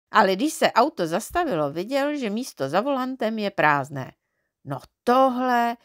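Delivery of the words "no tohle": pomalu, trochu hlasitěji, užasle; vykřikni to údivem; překvapené zvolání. A narrator's reading. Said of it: "vykřikl". (Neználek žasne nad prázdným autem, pomalu)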